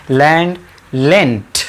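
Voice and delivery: लैंड ending on a clear D, then लेंट ending on a crisp T, said slowly; teacher slowly contrasting the final sounds of लैंड and लेंट; d versus t sound. Shown as a demonstration. Teacher showing the class the D and T endings, slow and deliberate. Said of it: "इसमें डी का sound लेंगे और उधर टी का sound लेंगे".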